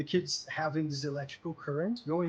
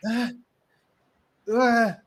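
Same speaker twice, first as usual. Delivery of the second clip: loud pained groaning cries; loud anguished groan; 8 semitones higher, 10 dB louder than usual. Acted. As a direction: cry out in pain like a tormented kid. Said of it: "You hear".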